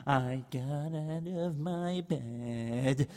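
put-on soft, slow, drowsy old man; sleepy old rabbi. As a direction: mumble as if just woken.

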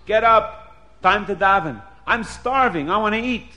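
loud, high, impatient yell; hungry host bellowing orders; shouted wake-up. Acted. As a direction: holler to rouse the sleepers. Host wakes guests, demanding food.

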